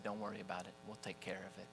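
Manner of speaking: very soft, calm kindness; gentle reassurance. Retold or imitated. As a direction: lift the weight off the boy. Mister Raymond forgives the debt.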